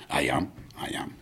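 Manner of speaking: soft whispered meek reply; timid whisper. Retold or imitated. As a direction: answer shyly, barely voiced. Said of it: "He went".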